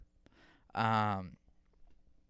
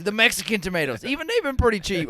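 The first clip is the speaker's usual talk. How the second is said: put-on much higher, louder and fast old-man voice; imitating grandpa; 11 semitones higher, 9 dB louder, faster than usual. Grandson retells grandpa insisting on cheap tomatoes, high fast imitation. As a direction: insist on it, pitched way up. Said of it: "He goes".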